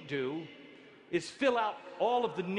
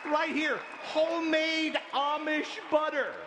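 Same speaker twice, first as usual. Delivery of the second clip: louder and higher, mock triumphant; proud reveal; 8 semitones higher, 4 dB louder than usual. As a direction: show off the smuggled butter, half joking. Shows contraband butter proudly.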